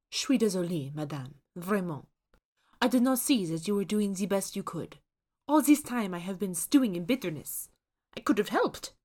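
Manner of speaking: put-on remorseful French accent; apologetic French accent. A narrator's reading. Ghost chef apologises to Susan, French accent. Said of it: "The chef's accent is thickly French".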